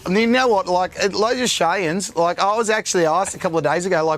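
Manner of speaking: laid-back Australian accent. Shown as a demonstration. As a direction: talk like a relaxed Aussie. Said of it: "you do such a good Australian accent too".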